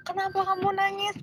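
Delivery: high-pitched imitated question; voiced lecturer. Lecturer to crying student, high imitated questioning. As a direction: play the lecturer asking, pitched well up.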